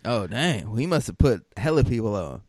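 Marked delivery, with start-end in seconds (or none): none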